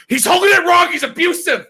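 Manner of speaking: very high, loud hysterical accusation; mock outrage. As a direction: shriek like an overblown online scold. Mimics outraged reply to puppy.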